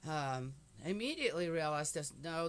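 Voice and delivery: ordinary talk, nothing marked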